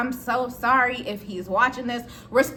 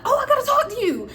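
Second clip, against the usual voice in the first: high, emphatic call-out; animated coworker; 12 semitones higher, 4 dB louder than usual. Acted. Happy walking in on the man, high-pitched and eager to talk. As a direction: pitch it way up as you collar someone.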